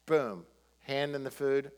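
ordinary talk

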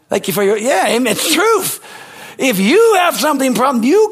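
high, loud, driving sermon delivery; full-force preaching. Real, not acted. Pastor preaching to the congregation at full volume. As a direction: preach it hard, pushing every line up.

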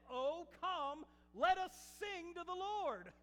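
high, loud, ringing summons; rousing psalm call. Retold or imitated. Preacher quotes David to the congregation, calling out loudly. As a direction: call the crowd to join in.

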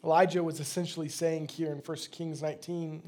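ordinary talk, nothing marked

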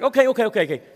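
rapid okays up high and loud. Retold or imitated. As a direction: brush it off, impatient. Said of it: "he's like".

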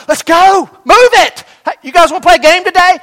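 loud, high shout; coach shouting. Retold or imitated. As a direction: drive the runners on hard.

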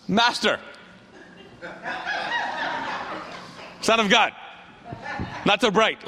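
lightly sarcastic address; hint of mockery under respectful titles; mild sarcasm. Peter addressing Jesus in the crowd, mildly sarcastic. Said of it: "a little bit of sarcasm".

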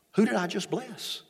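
a father starting to realise something is wrong; puzzled question. Acted. Isaac realises he was tricked.

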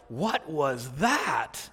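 slow, low, hushed bewilderment; retold awestruck question, stretched and quiet; hushed amazement. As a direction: act out stunned wonder, drawn out and soft. Retold stunned group reaction.

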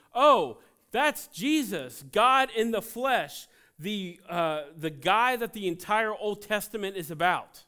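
excited realization. Acted. Blind man recognizing Jesus, loud slow wonder. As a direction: cry it out like a revelation.

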